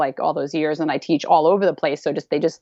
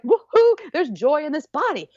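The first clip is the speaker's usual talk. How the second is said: cheering in her own body; woohoo joy; 9 semitones higher than usual. Real, not acted. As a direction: whoop it out with joy. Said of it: "I'm pulsing my pelvis right now".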